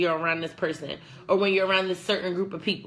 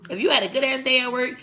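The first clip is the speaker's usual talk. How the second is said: ordinary talk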